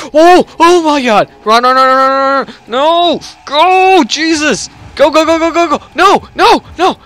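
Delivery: frantic high yelling to run; screaming in terror as something chases; panicked screaming. Real, not acted. Gamer fleeing a monster, screaming in panic.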